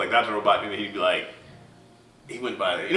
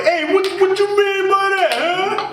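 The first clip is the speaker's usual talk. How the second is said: much higher, louder New York challenge; New Yorker tough guy; 7 dB louder than usual. Acted. Raphael the super New Yorker confronting someone, loud New York accent. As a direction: get in his face, New York style. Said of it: "They had Raphael like".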